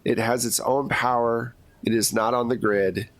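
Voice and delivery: ordinary talk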